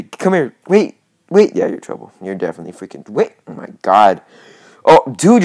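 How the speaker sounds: ordinary talk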